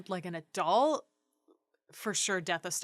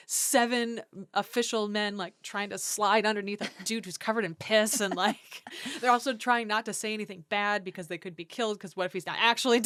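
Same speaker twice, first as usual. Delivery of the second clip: normal talking voice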